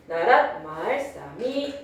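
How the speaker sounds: "나랏, 말, 싸미" drawn out with tonal rises; 중세국어 성조 낭독. Shown as a demonstration. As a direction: chant "나랏, 말, 싸미" slowly, "말" rising. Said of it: "이렇게 발음했다고". ("나랏, 말, 싸미"를 성조대로 길게)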